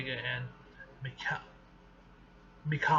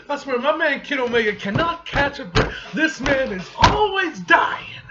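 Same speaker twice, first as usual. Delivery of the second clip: higher, louder, fed-up cry over a favourite hero; exasperated outburst; 9 semitones higher, 11 dB louder than usual. Real, not acted. Fan venting to viewers about Kid Omega, loud exasperation. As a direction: throw your hands up, the poor guy keeps dying.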